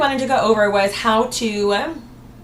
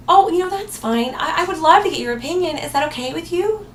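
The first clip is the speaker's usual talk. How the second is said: tentative; 6 semitones higher than usual. Shown as a demonstration. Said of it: "I'm kind of tentatively saying maybe".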